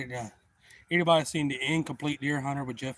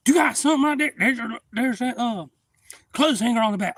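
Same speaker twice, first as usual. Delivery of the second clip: high yelling; 11 semitones higher, 9 dB louder than usual. Retold or imitated. Retold buddy quote.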